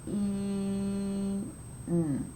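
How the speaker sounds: "P, in" in separate sounds, the i drawn out; "P, in" split with gaps for counting; sounding out. Shown as a demonstration. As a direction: say "P, in" sound by sound, slowly. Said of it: "count the sounds again".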